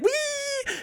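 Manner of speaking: long, very high squeal; high-pitched whee. Retold or imitated. Kid flying down the hill, long high squeal. Imitating himself hurtling downhill.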